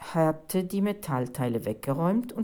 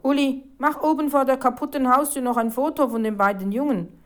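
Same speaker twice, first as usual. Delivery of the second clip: loud, raised, excited order; eager instruction; 6 semitones higher, 5 dB louder than usual. A narrator's reading. Reporter ordering a photo of the boys, excited. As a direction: keep the excitement up while directing.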